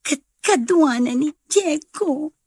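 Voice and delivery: bursting to share the news; excited gush. Acted. Woman telling her friend Diego has come, excited gush.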